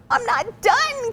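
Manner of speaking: put-on high, slow wail of a child not finished; crying out. A child protests to her teacher, high slow cry. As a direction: plead to keep drawing, high and drawn out. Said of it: "cries Amy".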